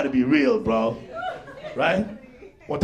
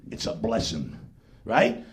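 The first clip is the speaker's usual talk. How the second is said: normal talking voice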